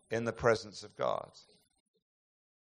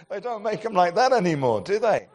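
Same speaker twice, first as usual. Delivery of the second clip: laughing as he speaks, louder and higher; amused aside, laughing; 7 semitones higher, 8 dB louder than usual. Real, not acted. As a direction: chuckle through the words.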